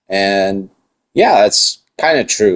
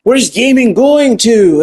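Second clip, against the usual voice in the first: mock surprise; 12 semitones higher than usual. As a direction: gasp it out like a shocked fan. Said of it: "they sound surprised".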